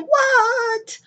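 very high, squeaky shocked what; stunned what. Real, not acted. She sees her podcast numbers jump and squeaks in shock. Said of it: "surprised at her numbers".

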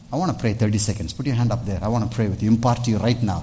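normal talking voice

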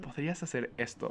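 soft, tactful suggestion; gentle request. Pretend. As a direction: ask it mildly, without any edge. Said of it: "más suave".

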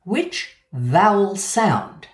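Which vowel sound dragged out very slowly; slow emphatic question. Shown as a demonstration. Teacher slowing down to press the key question, slow emphasis. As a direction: spell it out slowly, leaning on each word. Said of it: "the key word in the question is sound".

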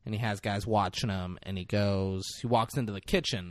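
ordinary delivery

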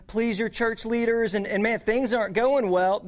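ordinary talk, nothing marked

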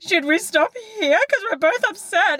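high, wobbly voice of a mum choked up mid-story; a hint of a weepy mum asking to stop; tearful mother's voice.

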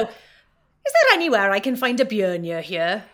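slow, loud Welsh Valleys accent; a Welsh Valleys line for learners. Shown as a demonstration. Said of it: "give me the example".

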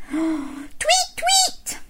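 tiny, very high bird chirps; tweeting birds. Acted. Storyteller being the birds that wake Bear, high tweeting. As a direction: chirp softly and squeakily like a bird. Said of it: "went the birds".

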